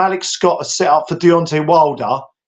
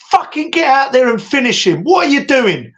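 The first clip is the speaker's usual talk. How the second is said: much higher, urgent berating cornerman; Emmanuel Stewart; 10 semitones higher than usual. Retold or imitated. Coach to Klitschko in the corner, urgent berating. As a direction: imitate a coach tearing into his fighter. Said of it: "Emmanuel Stewart got Klitschko in the corner".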